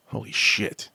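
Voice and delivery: breathed out in a real whisper, struck; whispered holy shit. Real, not acted. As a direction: whisper it, stunned by the quote. Quote hits him hard.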